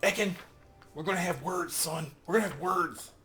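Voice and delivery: high loud mock scolding; hammed-up we-need-to-talk warning; mock stern parent. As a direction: threaten the companion like a cross parent. Scolding a game character.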